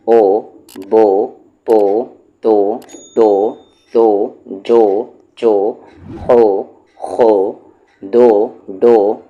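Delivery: loud, deliberate classroom chant; syllable drill. Shown as a demonstration. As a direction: call out each syllable like a reading teacher. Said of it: "teacher drills syllables with o sound".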